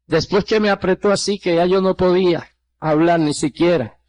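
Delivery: normal talking voice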